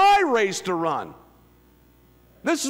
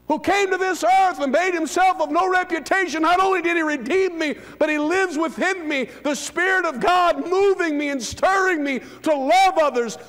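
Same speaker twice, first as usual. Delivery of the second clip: preaching at full force; 6 semitones higher than usual. Acted. Preacher lifting the church about who works in them, full-force preaching. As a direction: build the congregation up to God. Preaching climax, shout on Creator God.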